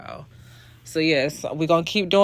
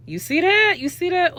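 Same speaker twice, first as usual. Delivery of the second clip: high, thrilled "look at that!"; excited; 11 semitones higher than usual. Real, not acted. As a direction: squeal it out, showing off the result. Showing off a finished brow.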